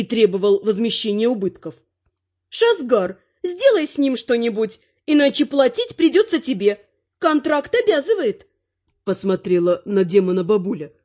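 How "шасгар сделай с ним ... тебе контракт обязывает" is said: high-pitched granny giving orders; bossy old lady, pitched up; demanding granny. A narrator's reading. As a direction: press the demand in a raised granny voice. Narrator voices the grandmother.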